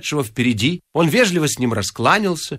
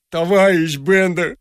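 higher, slow pleading whine; 6 semitones higher, slower than usual. A narrator's reading. Vorobyaninov begging Bender not to send him off, pleading. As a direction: draw it out as a plea. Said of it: "взмолился Воробьянинов".

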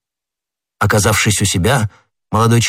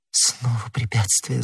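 soft, slow, hurt murmur; pained whisper; 8 dB quieter, slower than usual. A narrator's reading. Madame Bonacieux murmurs to d'Artagnan in pain, softly. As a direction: breathe it out in pain. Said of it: "с болью прошептала".

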